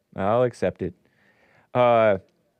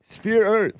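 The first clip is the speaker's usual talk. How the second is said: Sphere Earth pitched higher and punched; mock emphatic; 7 semitones higher than usual. Shown as a demonstration. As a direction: announce it like a correction. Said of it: "same as ical in spherical".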